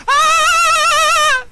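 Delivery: very high, loud, held imitated shriek; woman's scream. Priest imitating a woman screaming during the service, piercing scream. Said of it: "escucharon a una mujer gritar".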